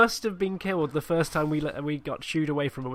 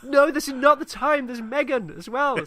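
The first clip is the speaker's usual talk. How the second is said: panicked; 10 semitones higher than usual. Retold or imitated. Shipmate panicking at second monster.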